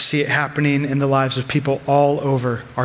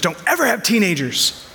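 weary, higher voice of a fed-up mother; exasperated mom; 6 semitones higher than usual. Retold or imitated. Frazzled mother warning a stranger, mildly exasperated. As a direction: sigh it out like a worn-out mom. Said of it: "And she just goes".

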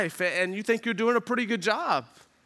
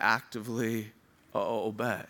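dropped low and soft; 10 semitones lower, 5 dB quieter than usual. Acted. Preacher's repeated key phrase.

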